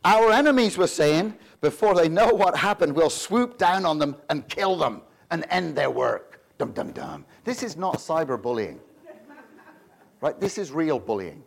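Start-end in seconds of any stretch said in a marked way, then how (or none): 0.1-6.4 loud, dramatic scripture read; ominous Bible reading. Shown as a demonstration. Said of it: "verse eleven". 6.6-7.2 lower, soft dramatic sting; mock ominous dum dum dum. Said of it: "mock suspense sound".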